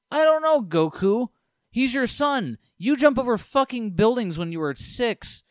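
high, loud, sarcastic retort; mocking high voice. Mocking reply to Goku.

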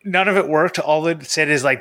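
usual speaking voice

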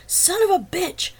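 high, loud, slow furious yell; angry shout. A narrator's reading. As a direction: bellow it in rage. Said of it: "Wagner repeated".

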